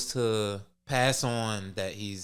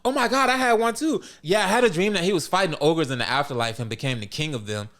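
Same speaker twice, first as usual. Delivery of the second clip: high, louder gushing; mock excited; 7 semitones higher, 4 dB louder than usual. Keiko telling mom about her dream, imitated excitement. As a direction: burst in high, hammed-up and thrilled. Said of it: "Keiko got all excited".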